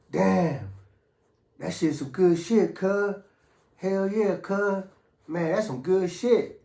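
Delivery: mock high-pitched, drawn-out admiration; impressed buddies. Inmates praising the coke to each other, impressed. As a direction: play dudes savoring a good high.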